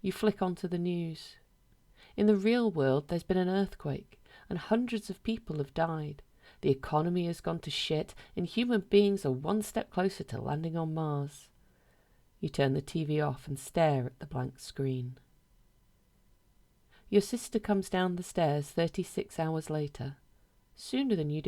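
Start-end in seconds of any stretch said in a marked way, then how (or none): none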